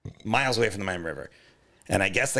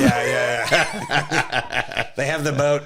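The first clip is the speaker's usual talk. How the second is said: laughing; 5 semitones higher, 5 dB louder, much slower than usual. Real, not acted. Laughing at absurd map.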